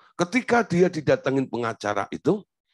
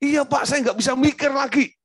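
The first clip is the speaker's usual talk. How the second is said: panicked wife; 12 semitones higher than usual. Retold or imitated. Frightened wife crying out to the pastor on the phone, panicked.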